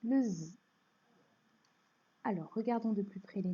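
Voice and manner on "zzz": Z held as a voiced buzz; buzzing Z sound. Shown as a demonstration. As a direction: sing out the Z sound, zzz. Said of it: "la lettre S chante".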